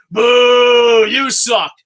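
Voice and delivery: loud mock crowd boo; imitating the internet booing a wrestler. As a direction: jeer like an arena full of fans. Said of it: "you hear the internet entry".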